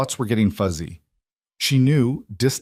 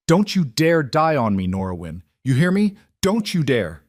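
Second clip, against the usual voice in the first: desperate, raised voice pleading; desperate shouting; 6 semitones higher, 3 dB louder than usual. A narrator's reading. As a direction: shout to keep her alive. Pleading with dying woman.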